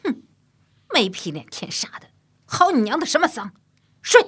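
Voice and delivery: loud, high spat insults; seething curse. A narrator's reading. Still-furious mother curses and orders her son to sleep, loud and spiteful. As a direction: curse him and snap the child to sleep. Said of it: "气恨难当".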